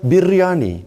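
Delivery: biryani, trying hard to roll the R; learner's rolled-R biryani; rolled r attempt. Shown as a demonstration. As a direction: roll the R in biryani, a bit forced. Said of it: "you roll R's".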